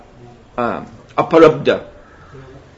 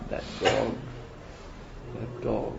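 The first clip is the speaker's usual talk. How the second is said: faint, frail and much softer; very weak voice; 13 dB quieter than usual. Retold or imitated. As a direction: barely get the words out, frail and faint. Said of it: "in a very weak voice".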